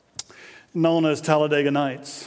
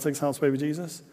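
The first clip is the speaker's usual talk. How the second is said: ordinary talk, nothing marked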